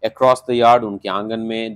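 ordinary talk

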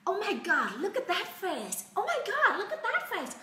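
high-pitched, giddy gushing; smitten squeal. Acted. Teacher acting smitten at seeing his face, high giddy gushing. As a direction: gush in a high, laughing voice.

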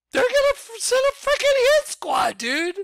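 loud, very high mock panic; panicked outburst. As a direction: shriek it like they're already coming for you. Fearing the Vatican's reaction.

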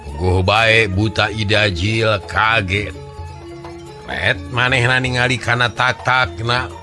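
ordinary delivery, nothing marked

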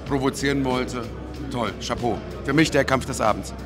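ordinary delivery

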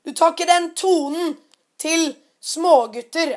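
a loud, high "Hei!" then a slow, stern telling-off; the father's furious rebuke, slowed down; angry shout. Retold or imitated. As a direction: scold a grown man for bullying kids. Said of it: "sier faren til Philip".

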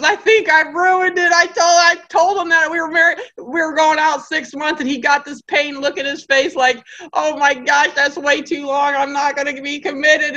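put-on girlfriend fretting on the phone; panicked girlfriend. Girlfriend on phone to friend, panicked and fretful.

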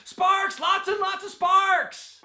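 high-pitched shout, drawn out at the end; manic mock excitement. Reviewer mocking the movie's effects budget, manic high shout. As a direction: shout it like a crazed effects fan.

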